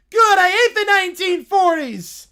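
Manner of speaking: high, loud bratty complaint; whiny kid. Acted. Bored kid complaining to the scientist, loud and high. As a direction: sulk out loud like a bored child.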